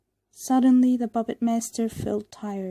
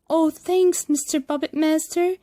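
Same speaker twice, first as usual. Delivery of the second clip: loud, higher thrilled thanks; delighted thanks; 7 semitones higher, 7 dB louder than usual. A narrator's reading. Pinocchio thanking the puppet master, excited and loud. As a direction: burst out with gratitude.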